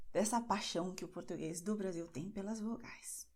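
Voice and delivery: usual speaking voice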